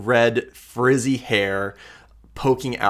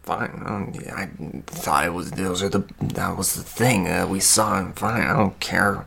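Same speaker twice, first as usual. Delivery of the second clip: Sorrel; 2 semitones lower, 4 dB quieter than usual. Acted. Sorrel hugs her bowl after being snapped at, huffy. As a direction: grumble it softly into your bowl. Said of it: "huffs. She, like, takes her bowl".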